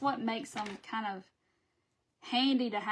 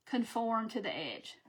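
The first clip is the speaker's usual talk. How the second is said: ordinary talk, nothing marked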